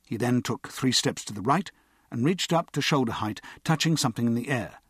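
usual speaking voice

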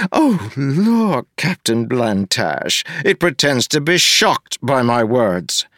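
a pompous official looking down on everyone; smug mayor. Acted. Mayor Gave demanding an apology from Wax, sneering.

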